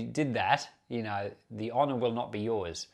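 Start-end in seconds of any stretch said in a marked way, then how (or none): none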